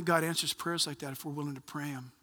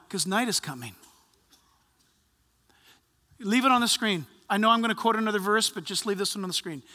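ordinary talk

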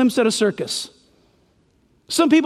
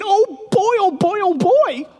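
higher, a touch of giddy chanting; eager Herod; 8 semitones higher, slower than usual. Retold or imitated. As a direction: bounce on each oh boy like a kid. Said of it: "He has been waiting".

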